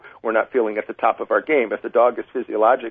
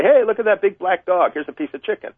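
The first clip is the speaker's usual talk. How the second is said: bright, higher happy call to the dog; upbeat cheer; 8 semitones higher than usual. Shown as a demonstration. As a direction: make the passing dog sound like a treat. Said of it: "You say".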